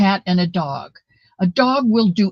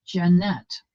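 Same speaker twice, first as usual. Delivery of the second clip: ordinary delivery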